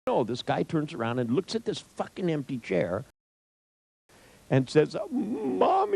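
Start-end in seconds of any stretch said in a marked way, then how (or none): 5.1-5.9 high-pitched whiny plea to a mother; hammed-up, high childlike call; mock pleading cry. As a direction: imitate a grown man wailing for his mom. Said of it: "starts crying".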